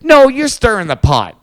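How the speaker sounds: loud, slow, high accusation; loud accusing. Retold or imitated. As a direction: shout the accusation slowly. Quoting accusers loudly.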